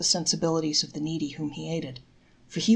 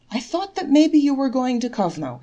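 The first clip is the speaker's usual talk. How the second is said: lame answer; 7 semitones higher, 7 dB louder than usual. A narrator's reading. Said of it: "answered Reb Nochemki lamely".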